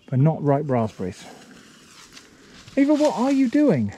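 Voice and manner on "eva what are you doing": calling out. Real, not acted. Calling to her dog.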